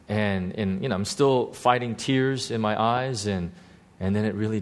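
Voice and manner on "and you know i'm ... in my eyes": normal talking voice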